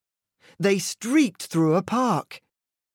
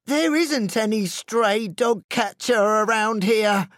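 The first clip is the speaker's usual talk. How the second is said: scoffing Hot Dog; 6 semitones higher, 3 dB louder than usual. A narrator's reading. Hot Dog dismisses warning.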